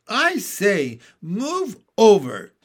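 loud, high-pitched protest. A narrator's reading. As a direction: call out loudly to make someone move. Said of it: "said John in a loud voice".

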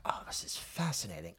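voiceless whisper of excited wonder; mock whisper. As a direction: breathe it like a secret discovery. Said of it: "I'm like".

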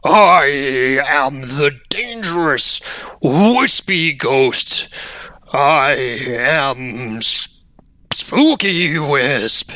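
slow, drawn-out ghostly voice; Spooky Wisp. Acted. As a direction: stretch every word like a pompous ghost. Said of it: "it calls out to you. It says".

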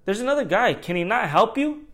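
calling out to the sandwich guy, impatient; retold impatient call across the counter. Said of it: "I was like".

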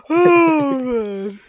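high squealing laughter; laughing hard. Real, not acted. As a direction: crack up high and loud.

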